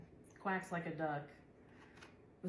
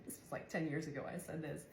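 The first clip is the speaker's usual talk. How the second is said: usual speaking voice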